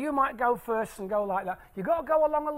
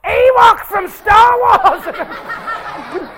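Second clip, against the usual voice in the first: high, drawn-out shout; mimicking yelling kid; 10 semitones higher, 12 dB louder, much slower than usual. Comedian imitating one teenager calling out after the chorus, high yell. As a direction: yell it slowly, like one kid over the crowd.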